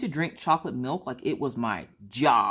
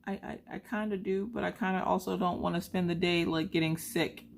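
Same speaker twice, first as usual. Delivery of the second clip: torn whine; 7 semitones higher, 3 dB quieter than usual. Retold or imitated. Mom torn about chocolate milk.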